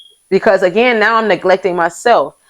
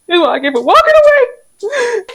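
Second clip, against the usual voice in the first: sing-song higher echo of the line just said; mock high voice; 14 semitones higher than usual. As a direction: repeat the line much higher, in a put-on voice. Repeats the line in a voice.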